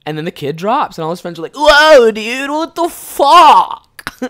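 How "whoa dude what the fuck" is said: high, loud, drawn-out outcry; shocked shouting. Acted. Kid's friends react to seeing him punched, shocked shouting. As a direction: yell it like stunned friends, stretching the last word.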